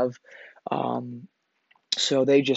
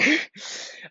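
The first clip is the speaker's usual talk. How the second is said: laugh. Real, not acted. Laughs at own blunder.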